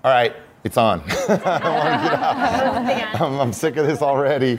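high, laughing whine of giving up; laughing protest. Retold or imitated. Student on yoga mat laughing, begging to quit. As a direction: laugh through wanting out of the pose. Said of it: "And I was like".

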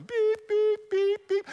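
high, steady electronic beep imitation; evenly spaced high-pitched beeps; monitor beeps. Imitates hospital monitors restarting.

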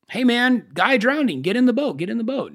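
high, urgent hail from a boat; a mild mock rescuer's shout; calling out. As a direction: call across the water to a drowning man. Second boat calls to him.

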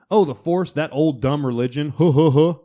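high, loud jeering with mock laugh; loud scoffing council member; mocking scoffers. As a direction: sneer at the old religion. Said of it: "everybody kept going".

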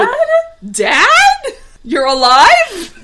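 high, loud, slow disbelief; shocked. Retold or imitated. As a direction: gasp it out in shock. Shocked retold reaction.

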